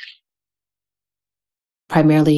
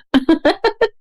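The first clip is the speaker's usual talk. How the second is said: a quick high laugh at the joke; bright high laugh; 13 semitones higher than usual. Real, not acted. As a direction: laugh along, delighted. Laugh after astrology joke.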